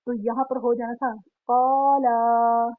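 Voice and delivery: slow and stretched कौला; long vowel demo. Shown as a demonstration. Teacher modeling the long vowel for students. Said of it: "लेकिन अगर लाम अलिफ होता".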